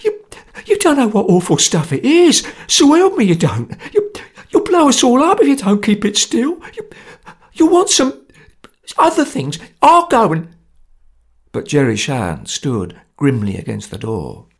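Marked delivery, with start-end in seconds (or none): 0.0-10.5 high, loud stammering panic; a scared man trying to escape, much higher and louder; terrified babbling. Acted. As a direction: plead desperately, tripping over words.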